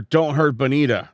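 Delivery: ordinary talk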